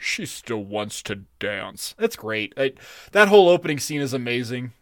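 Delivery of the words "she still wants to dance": much lower, softer and slow, deadpan; Dooley mumbling low and slow; quoting Dooley. Retold or imitated. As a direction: drop way down and drag each word out like Dooley.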